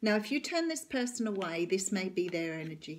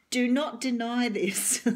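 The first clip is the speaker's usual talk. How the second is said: slow, louder, still laughing; laughing through it; 3 semitones higher, 8 dB louder, slower than usual. Real, not acted. Telling the client not to deny it, laughing. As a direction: push the words out through a laugh.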